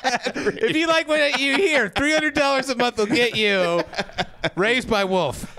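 slow, deliberate commercial pitch; ad read. Acted. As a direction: sell it like a radio ad. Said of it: "This is a sample".

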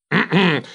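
short throat-clearing before a story. Acted. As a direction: clear your throat to begin. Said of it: "mi schiarii la gola".

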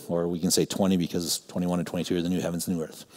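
ordinary talk, nothing marked

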